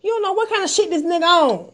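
high-pitched, agitated re-enactment of a warning; retold urgent warning. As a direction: re-enact pleading hard with a careless friend. Retells urgently warning the friend.

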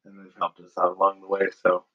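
usual speaking voice

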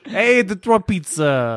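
high, drawn-out mock Detroit accent; hammed-up Detroit pizza guy accent. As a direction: stretch it out in a broad Detroit accent. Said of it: "Is that how they sound?".

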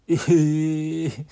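loud drawn-out "えー"; shocked gasp. Real, not acted. Reader hears a classmate died, loud shocked えー. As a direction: react to the death news in shock.

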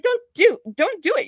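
high, loud pleading don't; mock-pleading with a wrestler; exasperated plea. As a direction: beg him to stop, voice jumping up.

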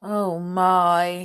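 mild, drawn-out dismay. A narrator's reading. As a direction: let the news sink in with mild alarm. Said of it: "said Binky in dismay".

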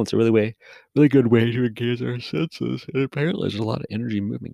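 talking through a yawn; words stretched by a yawn. Real, not acted. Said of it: "'cause I keep yawning recording this".

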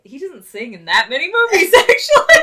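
loud, climbing into a high laugh; laughing protest. Real, not acted. Co-host explaining the short list of Ewan films, laughing protest.

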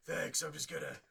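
high, loud creepy voice; creepy Batman voice. Acted. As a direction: play a cheerful creep with treats. Pedophile Batman arrives at sting.

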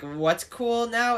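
high, slow goofy dad voice; imitating dad. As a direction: play the clueless dad trying to be cool. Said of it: "He was like".

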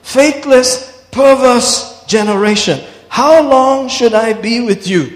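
ringing, high-pitched quoted rebuke; acted rebuke. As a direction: preach it as an exasperated reproach. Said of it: "Why is he talking like this to us".

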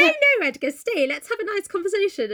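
high, over-eager mock politeness; forced cheerfulness. [name] urging Edgar to stay, forced cheerfulness. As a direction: start high and urgent, gloss it over.

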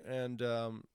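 normal talking voice

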